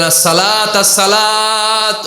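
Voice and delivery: high, long-drawn chanting appeal; impassioned preached plea. Acted. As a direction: stretch the words out on a high note. Preaches a prophet's last plea.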